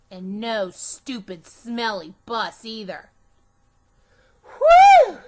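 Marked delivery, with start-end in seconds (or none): 4.4-5.2 loud, high whoosh of relief; a kid's exaggerated sigh of relief; big whew of relief. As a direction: blow out a huge relieved whew. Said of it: "I said".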